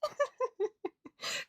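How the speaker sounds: squealing high laughter; high laughing fit. Real, not acted. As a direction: break into helpless laughter. Laughs at the bit.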